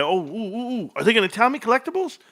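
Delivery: rising, softening thrilled oohs; excited ooh. Real, not acted. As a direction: squeal with delight. Thrilled at the numbers.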